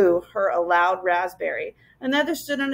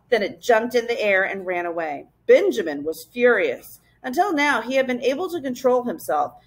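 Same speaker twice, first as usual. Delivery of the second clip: ordinary delivery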